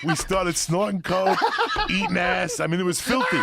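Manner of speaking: high, loud laughing telling; storyteller cracking up at his wild night, high and loud. Real, not acted. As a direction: laugh through the filthy story. Laughing recounting debauchery.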